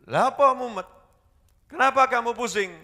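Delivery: high, loud nosy question; curious friend. Retold or imitated. Woman's friend at the gathering asking why she's dizzy, high and loud.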